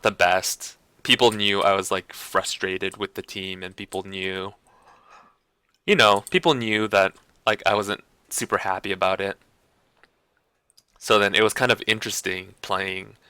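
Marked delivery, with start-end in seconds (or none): none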